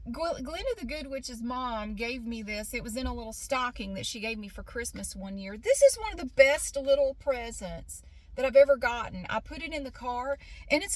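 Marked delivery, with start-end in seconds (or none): none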